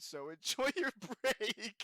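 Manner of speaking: slow, laughing through the words; can't keep a straight face; giggling sign-off. Retold or imitated. Quoting his own text, laughing.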